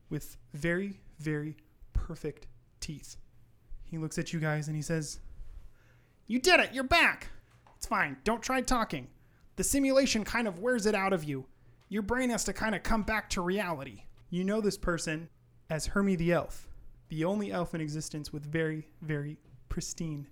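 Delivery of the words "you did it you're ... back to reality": high, loud, thrilled elf voice; excited elf. Acted. Elf greets players back from the simulation, excited. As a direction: burst out high, then reassure brightly.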